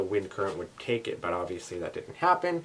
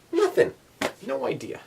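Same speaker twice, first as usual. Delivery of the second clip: baffled exclamation; 14 semitones higher than usual. Real, not acted. Baffled, bug not found.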